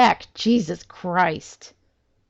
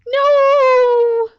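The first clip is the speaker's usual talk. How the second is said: high, loud drawn-out protest; 9 dB louder than usual. Real, not acted. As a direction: cry it out in horror. Reacting to near bomb death.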